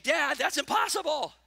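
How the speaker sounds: put-on retelling a child's loud complaint; whiny kid. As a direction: whine like a kid who gives up. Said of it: "They immediately began to whine".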